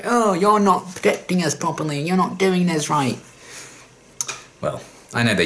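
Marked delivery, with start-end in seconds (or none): 0.0-3.2 mocking whiny complaint. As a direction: parody the whiny trainees in a pitched-up voice. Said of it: "be like".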